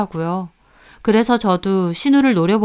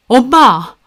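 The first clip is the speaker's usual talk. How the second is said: loud shout at mother; raised-voice objection; exasperated protest; 3 semitones higher, 7 dB louder, much slower than usual. Acted. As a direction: cut your mother off, appalled. Son cuts in on mother.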